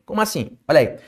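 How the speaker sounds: usual speaking voice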